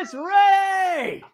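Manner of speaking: dramatic announcer. Pretend. Hyped intro voice.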